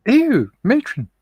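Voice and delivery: high, slow scandalised cry; mock prudish. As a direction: gasp it like a shocked matron.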